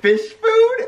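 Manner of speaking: high, incredulous "Fish food?"; surprised. Pretend. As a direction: squeak it out in disbelief. Surprised question about fish food.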